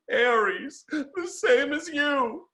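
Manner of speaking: high, slow, tearful mock sob; a mother crying, voice pitched high and drawn out; imitated sobbing. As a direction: squeeze it out through imitated tears. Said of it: "as my mother is sobbing".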